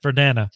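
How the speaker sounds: Verdana said "ver-DAN-uh", falling end; short A as in "Dan", decided Verdana; settled pronunciation. Shown as a demonstration. Streamer settling on a font name, Verdana with a short A. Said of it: "Let's go with that".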